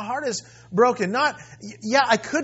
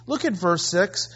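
usual speaking voice

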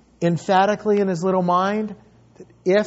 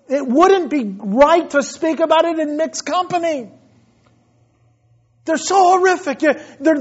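high, loud, impassioned preaching; 10 semitones higher, 6 dB louder than usual. Acted. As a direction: cry out the horror from the pulpit. Preaching at full force.

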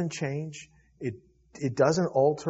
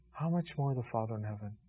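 softer echo of the question; quieter repeat; 3 semitones lower, 7 dB quieter than usual. Shown as a demonstration. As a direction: let it sink in, quieter.

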